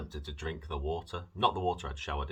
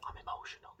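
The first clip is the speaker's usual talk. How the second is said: whispered confession; 8 dB quieter, faster than usual. Real, not acted. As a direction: whisper it, a little overcome. Confessing feelings about refusing coffee.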